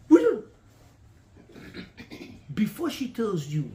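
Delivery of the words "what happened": higher, louder sharp demand; alarmed husband. Acted. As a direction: snap the question at her in alarm. Husband reacts to lost card.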